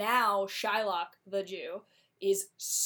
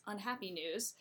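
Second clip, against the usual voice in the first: usual speaking voice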